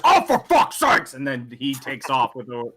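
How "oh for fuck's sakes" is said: high, loud, drawn-out outburst; exasperated dwarf. Acted. Dwarf reacting to his dice roll, exasperated shout. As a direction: burst out as a fed-up dwarf. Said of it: "The dwarf just says".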